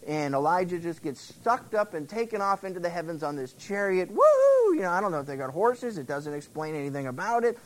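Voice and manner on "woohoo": high, loud joyful whoop; gleeful whoop. Pretend. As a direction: whoop like riding a rollercoaster. Chariot whisks Elijah to heaven.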